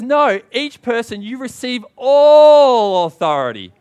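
slow, loud, high proclamation; a preacher thundering the words of Jesus; proclaiming Jesus. Retold or imitated. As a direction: boom it out slowly as Jesus giving all authority. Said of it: "He says".

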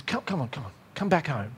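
soft, low fatherly beckoning; gentle coaxing. Acted. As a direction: coax a child home tenderly. God coaxing him back.

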